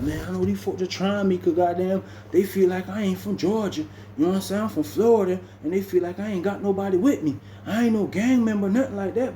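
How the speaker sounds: lightly voiced worried friend; worried complaint. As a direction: raise the pitch, venting his worry. [name] explaining he's targeted.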